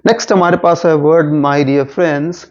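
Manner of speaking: ordinary delivery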